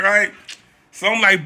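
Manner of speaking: normal talking voice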